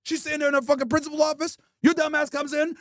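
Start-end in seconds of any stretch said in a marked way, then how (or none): none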